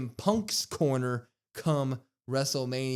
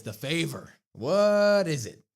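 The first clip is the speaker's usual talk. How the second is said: drawn-out, higher, louder question; retold wrestler's teasing build to a big question; CM Punk; 4 semitones higher, 6 dB louder than usual. Wrestler announcing the favor to his rival, mock-dramatic. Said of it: "Punk comes out, and he says".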